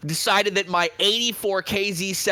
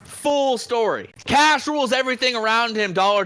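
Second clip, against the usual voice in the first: ordinary delivery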